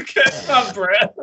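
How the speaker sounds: high, drawn-out teasing repeat; mocking echo. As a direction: parrot it back slow and squeaky-high. Co-host laughingly mocks the quoted phrase.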